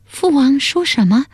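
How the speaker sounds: high-pitched stunned daughter; astonished. A narrator's reading. Princess reacting to her father's accusation, astonished. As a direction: ask in high, startled disbelief. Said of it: "阿盖满面愕然说".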